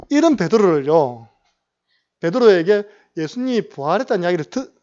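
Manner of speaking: usual speaking voice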